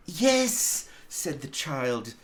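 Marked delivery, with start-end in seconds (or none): 0.1-0.9 put-on high, bright child's yes; gleeful yes. A narrator's reading. Little sister answering her brother, high gleeful yes. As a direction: burst out with glee. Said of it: "brimful with glee".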